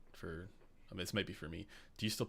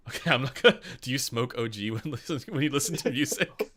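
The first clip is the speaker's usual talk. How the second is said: giggling through the question; barely holding back laughter; laughing; 6 semitones higher, 10 dB louder than usual. Real, not acted.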